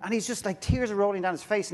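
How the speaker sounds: normal talking voice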